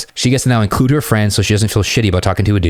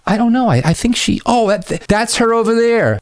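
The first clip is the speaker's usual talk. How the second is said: high-pitched hesitant searching, then spotting; hammed-up higher female voice; flustered woman imitated; 13 semitones higher than usual. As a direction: stammer high, then stretch out the discovery. Acted woman spotting her friend.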